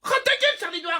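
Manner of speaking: high-pitched comic outburst; indignant retort. Acted. Mocked pupil to classmate, indignant retort. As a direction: snap back in a jumped-up pitch.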